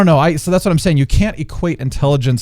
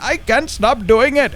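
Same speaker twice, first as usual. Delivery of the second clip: high, slow British accent; exaggerated British delivery, much higher; 10 semitones higher, slower than usual. Shown as a demonstration. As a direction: show off a put-on British accent. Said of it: "sometimes I can get into it".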